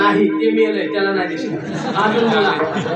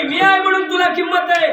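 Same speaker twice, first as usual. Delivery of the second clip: mild quarrel; 8 semitones higher, 3 dB louder than usual. Acted. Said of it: "Lakshmi म्हणे".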